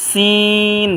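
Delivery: সিন with a long, level "ee"; drawn three counts. Shown as a demonstration. Teacher names the letter for learners, drawn long. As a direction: stretch the vowel of সিন evenly. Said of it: "এটাও তিন আলিফ টানবো".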